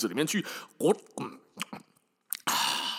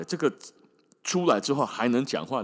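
usual speaking voice

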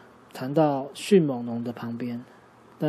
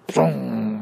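模仿炮声; 5 dB louder than usual. Acted. 镭射炮发射.

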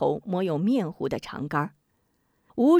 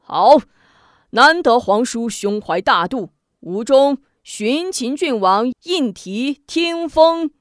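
grand proclamation; 4 semitones higher, 7 dB louder, slower than usual. Acted. Emperor proclaiming with flourish.

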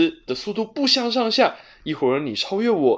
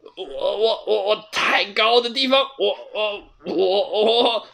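trembling mutter; 5 semitones higher than usual. A narrator's reading. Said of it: "球球一边发抖一边嘀咕".

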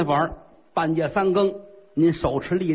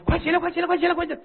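eager urging; 12 semitones higher, much faster than usual. Acted. Emperor eagerly urges monk up.

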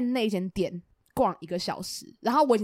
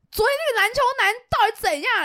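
much higher, louder complaint; exasperated outburst; 11 semitones higher, 4 dB louder than usual. Retold or imitated. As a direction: vent loudly to friends. Venting to friends, annoyed.

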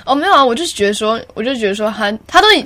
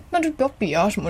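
softer, sulky muttered quote; petty complaint; 8 dB quieter than usual. Retold or imitated. Quoting a singer's petty complaint, muttered. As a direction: grumble it like a small whine. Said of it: "他是那种小抱怨".

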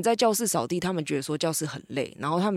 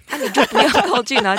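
high, loud retold telling-off; mock scolding; 8 semitones higher, 8 dB louder than usual. Teacher telling a child off for going near, mock scolding. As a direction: scold loudly, half laughing, imitating the teacher. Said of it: "就，就被念".

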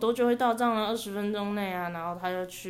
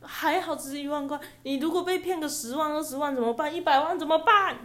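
rising alarm; 7 semitones higher, 4 dB louder than usual. Real, not acted. Alarm building.

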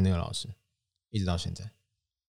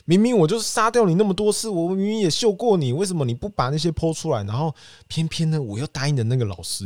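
high-pitched aggrieved rant, easing off gradually; pissed-off venting; 9 semitones higher, 3 dB louder than usual. Retold or imitated. Wronged gamer silently venting at streamers who boast, pissed off. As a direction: whine in outrage, then run out of steam. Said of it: "那时候我心里很干啊".

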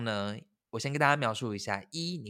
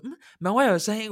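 higher, louder, perking up at a sound; hopeful alert; 10 semitones higher, 6 dB louder than usual. Retold or imitated. Trapped between doors hearing a ringtone outside, alert. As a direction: suddenly alert to a noise.

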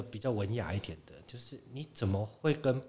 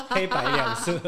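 high, louder words through a laugh; 12 semitones higher, 6 dB louder than usual. Real, not acted. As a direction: giggle while describing. Joking about old phones.

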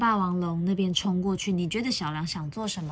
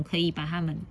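ordinary delivery

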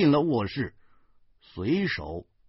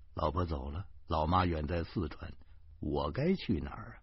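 low, soft, lost musing; downcast, lowered self-questioning; forlorn; 5 semitones lower, 8 dB quieter than usual. A narrator's reading. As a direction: wonder quietly with nowhere to go. Husband left alone at night.

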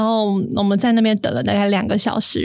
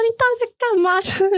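pitched way up, yelling 你到底在干嘛 at him in a rage; furious yelling; 13 semitones higher, faster than usual. Retold or imitated. Daughter yelling at her dad standing in the rain, enraged. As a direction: blow up at him on the street. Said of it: "我就在街上飙骂他".

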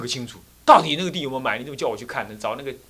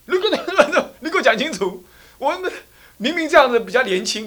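indignant scolding; 11 semitones higher, 7 dB louder than usual. Acted. Continued quarrel about beard.

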